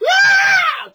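piercing anguished shriek. Shown as a demonstration. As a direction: let out a sudden high shriek of anguish. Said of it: "the word in the Greek means to shriek".

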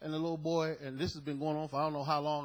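ordinary talk, nothing marked

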